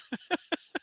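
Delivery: a sudden high, scheming villain laugh; high villainous cackle. Acted. Scheming bartender snaring regular customers.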